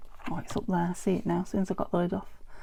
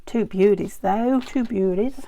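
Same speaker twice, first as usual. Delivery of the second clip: half-hearted Aussie twang on two beauties; a lightly put-on Australian accent, not fully committed; terrible Australian accent; 8 dB louder than usual. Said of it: "As the Aussies say".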